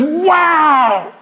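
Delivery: David exclaims at the heavens in awe; awed David. Acted. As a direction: shout it out, struck by the sky. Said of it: "David looks up and says".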